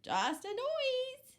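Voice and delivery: very high, slow piglet; squeaky pig. Acted. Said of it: "said Pig".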